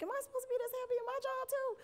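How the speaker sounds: fast squeal way up high; mock squeaky question. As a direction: toss it off in a quick high cartoon squeak. Mock self-doubt about being happy.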